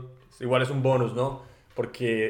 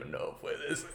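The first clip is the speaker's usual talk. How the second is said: stunned disbelief; 5 semitones lower, 8 dB quieter, much slower than usual. Retold or imitated. Shocked at learning the word.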